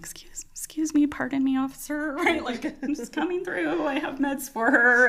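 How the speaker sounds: high, timid little excuse-me voice; meek politeness. Retold or imitated. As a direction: imitate a nervous newcomer politely squeezing through. Retold timid new nurse squeezing through.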